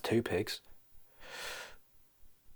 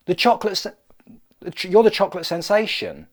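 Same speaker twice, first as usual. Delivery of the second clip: high, excited pig; hammed-up amazement; starstruck; 7 semitones higher, 4 dB louder than usual. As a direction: stammer in awe as the pig. Pig acted as awestruck by Colin.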